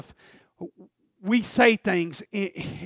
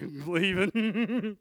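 suppressed glee; 5 dB quieter, much slower than usual. Retold or imitated. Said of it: "keep my smile to a minimum".

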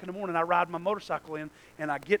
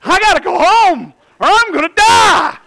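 loud, slow, much higher; urgent realization; 12 semitones higher, 18 dB louder than usual. Retold or imitated. Preacher telling how he came to his senses, loud and urgent. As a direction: proclaim it like it's life or death.

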